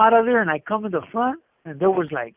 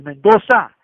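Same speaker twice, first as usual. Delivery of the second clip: imitating a shout from the back; retold loud holler; 9 dB louder than usual. Joe calls to the lieutenant from back there, hollering. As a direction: holler the name out, much louder. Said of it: "he hollered loud".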